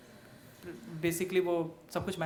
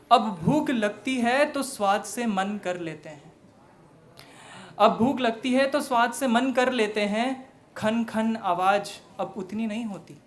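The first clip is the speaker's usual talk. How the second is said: declaimed verse with emphatic line openings; stage poem recitation; poetry recital; 4 semitones higher, 6 dB louder than usual. Acted. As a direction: recite to an audience, punching the openings and easing off at line ends.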